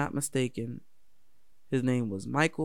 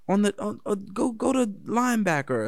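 coach shouting; 8 semitones higher, 4 dB louder than usual. Retold or imitated.

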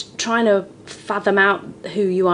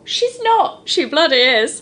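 high-pitched pretend bickering; comic high-voiced exchange; mock disbelief; 9 semitones higher, 4 dB louder than usual. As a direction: squeal it in playful outrage. Mock exchange about her age.

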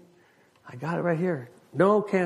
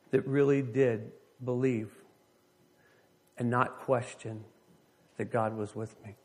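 reflective preaching; 2 semitones lower, 3 dB quieter than usual. Real, not acted. Reflective sermon questions.

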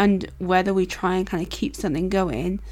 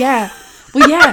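laughing; 5 semitones higher, 11 dB louder, slower than usual. Real, not acted. Laughing over embarrassing story.

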